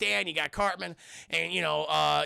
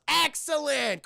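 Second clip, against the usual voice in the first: mock delighted approval; 7 semitones higher, 5 dB louder, slower than usual. Acting out pleased scientist.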